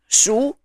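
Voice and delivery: shu rising upward; second tone. Shown as a demonstration. Teacher giving an example of the second tone, rising. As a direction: let the pitch climb through shu. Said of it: "the syllable with the second tone".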